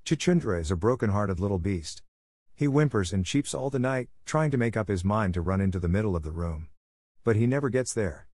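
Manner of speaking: plain reading voice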